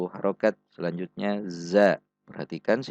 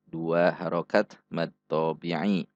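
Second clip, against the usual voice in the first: ordinary delivery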